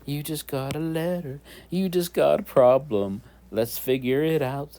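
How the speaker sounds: sing-song TV host imitation; Blue's Clues host. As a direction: imitate a children's show host announcing mail. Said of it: "The guy said".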